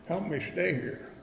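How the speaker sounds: ordinary delivery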